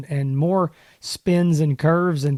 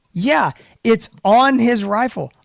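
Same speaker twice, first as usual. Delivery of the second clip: higher, louder, drawn-out mock disbelief; retold incredulous reply; 7 semitones higher, 6 dB louder, slower than usual. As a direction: stretch it out as if stating the obvious. Retold baffled answer to the company.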